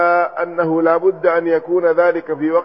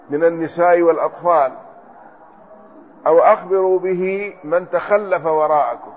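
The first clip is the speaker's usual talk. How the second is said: normal talking voice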